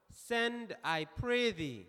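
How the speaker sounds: usual speaking voice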